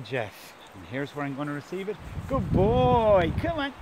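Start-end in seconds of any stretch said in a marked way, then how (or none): 2.3-3.7 high praise into a higher call; praising and calling. Real, not acted. Trainer ready to receive the dummy, high encouraging call. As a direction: praise the dog, then urge it in.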